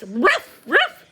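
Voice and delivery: yappy bark, the second jumping very high and loud; imitated demanding little dog barks. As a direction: yap like a small dog.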